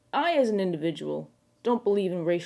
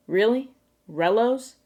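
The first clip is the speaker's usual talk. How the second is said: mock disbelief; 4 semitones higher, 4 dB louder, slower than usual. Mock disbelief at slang word.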